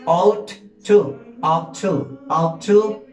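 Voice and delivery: "Ought to" said as ot-tu, no g or h sound; pronunciation drill. Shown as a demonstration. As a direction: model "Ought to" clearly for repetition. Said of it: "aquí no pronunciamos esas tres letras".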